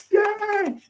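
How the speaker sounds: high-pitched mock cheer; crowd cheering. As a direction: squeal it like a whole crowd of fans. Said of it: "everybody was like".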